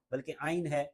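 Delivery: ordinary talk, nothing marked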